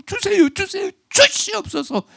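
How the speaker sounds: high wheedling mock plea; whiny begging. Preacher mocks whining prayer, high pleading whine. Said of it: "막 징징 울어가면서 소리 지르면서".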